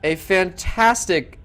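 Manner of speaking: drawn out, stunned praise; slow, awed wrap-up. Real, not acted. Caster to viewers after the final, slow awed wrap-up. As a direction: savour each word after the upset.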